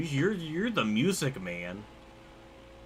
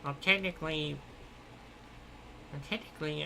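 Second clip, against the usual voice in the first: nerdy voice; 2 semitones higher, 7 dB quieter than usual. Pretend. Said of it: "it's my nerdy voice".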